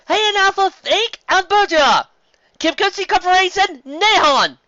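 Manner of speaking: slow, high, loud announcer; slow, loud stage announcer. A narrator's reading. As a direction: call the names slowly and loudly from the stage. Announcer reading results.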